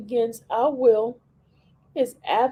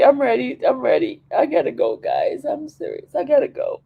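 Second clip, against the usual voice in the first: ordinary talk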